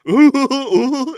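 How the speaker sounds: high wheezing ooh-ooh laugh; mock that guy's wheezing laugh, loud and high; wheezy laugh impression. Imitating a man's wheezy laugh for a co-host. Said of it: "Isn't that how that guy laughed?".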